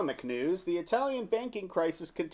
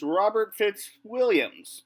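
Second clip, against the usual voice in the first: normal talking voice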